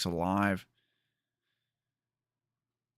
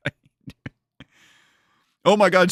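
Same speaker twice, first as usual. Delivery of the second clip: ordinary talk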